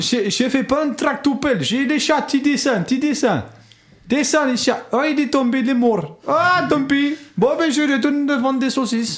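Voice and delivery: high-pitched mock Portuguese accent; bad Portuguese accent. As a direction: put on a terrible Portuguese accent. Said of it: "Tu dois faire un accent portugais".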